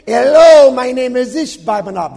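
high, very loud mock Philistine accent. Giant introduces himself to the king, booming Philistine accent. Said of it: "This is a Philistia accent here".

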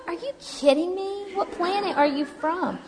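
high-pitched retold disbelief, stretched out at the end; incredulous. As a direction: act out being baffled by absurd praise. Her incredulous thought at his cheer.

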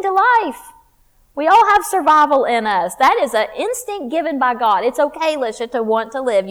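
fired-up preacher; preaching at full force. Acted. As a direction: drive the point home to the congregation.

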